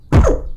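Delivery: loud monster growl. Retold or imitated. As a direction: roar to startle someone. Jump-scaring a friend from behind.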